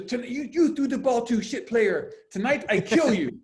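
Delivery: high, loud furious yell in broken English; angry coach yelling. Retold or imitated. Little Italian coach threatening player at timeout, loud furious yell. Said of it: "He's yelling, he goes".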